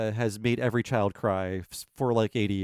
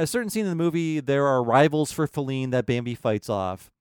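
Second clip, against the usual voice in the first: ordinary delivery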